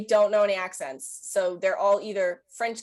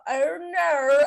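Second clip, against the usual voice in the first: put-on high, louder "Oh, no" in a strong accent; 7 semitones higher, 4 dB louder than usual. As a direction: stretch out "Oh, no" like a thick accent. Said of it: "people with the strong accents will go".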